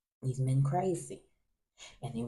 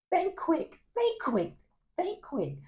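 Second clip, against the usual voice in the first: high, urgent repeated self-push; urgent inner voice; 12 semitones higher, slower than usual. Retold or imitated. Urging himself to find a way out, urgent. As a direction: hurry yourself to think. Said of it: "So I'm like".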